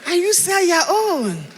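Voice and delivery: slow, deliberate, unbothered; slow firm retort. Pretend. Telling the crowd how to answer hinderers, slow and deliberate. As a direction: say it slowly and calmly back at them. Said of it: "Just look at them and say".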